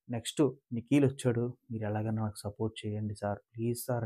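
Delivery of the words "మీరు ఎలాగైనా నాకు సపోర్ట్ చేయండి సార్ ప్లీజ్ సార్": softly imitating a begging contestant; much softer, mock-sorrowful plea to sir; a hint of a hurt contestant begging for support; sad pleading imitation. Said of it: "బాధపడిపోతున్నాడు".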